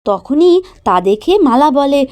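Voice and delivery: ordinary delivery, nothing marked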